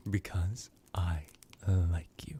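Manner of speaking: slow, half-whispered confession; intimate whisper. Acted. Ruler telling a captive servant of their fondness, intimate half-whisper. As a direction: breathe it out slowly, word by word.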